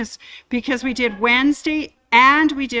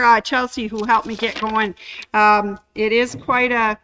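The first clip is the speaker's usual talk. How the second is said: normal talking voice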